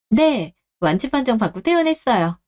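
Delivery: usual speaking voice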